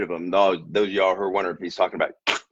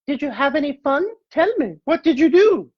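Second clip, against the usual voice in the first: very high falsetto mom voice, louder at the end; mother asks the children what they did, in falsetto; mother's falsetto; 3 dB louder than usual. Acted. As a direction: squeak out the mother's cheery question.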